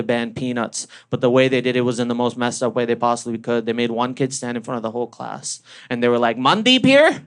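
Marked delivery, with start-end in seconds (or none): 6.4-7.2 higher, louder slow teacher warning; teacher announcement. Retold or imitated. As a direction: lecture the class like a grade-three teacher.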